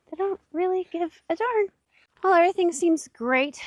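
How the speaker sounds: high, unbothered pet voice; voicing the pet. Speaking for her pet in a high don't-care voice. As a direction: speak for the animal, breezy and indifferent.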